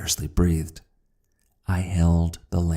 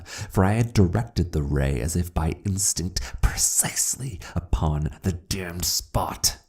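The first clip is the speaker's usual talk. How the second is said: ordinary delivery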